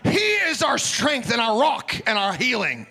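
high, shouted commands to spirits; preaching at full force. Real, not acted. Deliverance prayer at full force.

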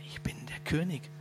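unvoiced secret whisper; whispered reveal. The disguised king whispers to his beloved, hushed. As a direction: let the reveal slip out in a whisper.

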